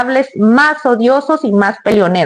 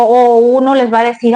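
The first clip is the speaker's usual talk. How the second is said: ordinary talk, nothing marked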